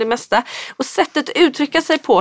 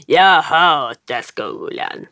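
low, slow grumpy moan; grumpy smurf; 7 semitones lower, much slower than usual. Retold or imitated. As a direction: drag it out like a sulky Grumpy Smurf. (långsam, låg sur buttesmurfsröst)

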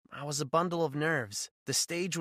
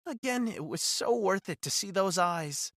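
usual speaking voice

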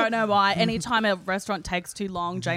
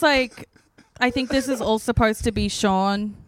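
ordinary talk

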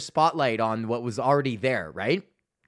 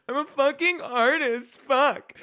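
much higher, hammed-up "I'm a fucking artist"; mock pompous artist; 11 semitones higher, slower than usual. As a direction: repeat it as a self-important artist, overblown. Mocking his own artist pose.

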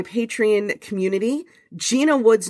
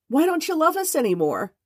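higher, rising plaintive cat voice; pitiful cat plea; 8 semitones higher than usual. Acted. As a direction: beg like a starving kitten. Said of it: "Clayton opts for pathos".

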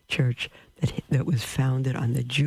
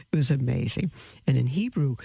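normal talking voice

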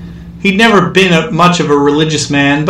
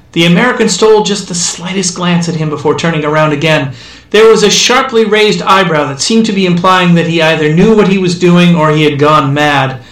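usual speaking voice

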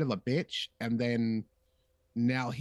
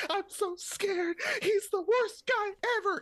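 much higher, slow, crying falsetto; mock terrified Padmé; slower than usual. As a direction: whimper it like a hammed-up scared heroine.

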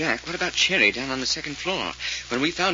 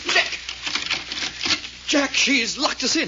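higher, slow alarmed call; 9 semitones higher, slower than usual. Acted. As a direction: call out shocked at the locked door. Reggie finds the door locked.

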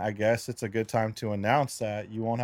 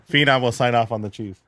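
usual speaking voice

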